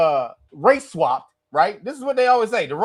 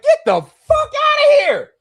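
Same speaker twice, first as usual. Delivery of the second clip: much higher, louder, stretched-out yell; outraged shout; 9 dB louder, slower than usual. Retold or imitated. Fed up with the movie, yelling at the screen. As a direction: scream it at the screen in disbelief. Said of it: "I was like".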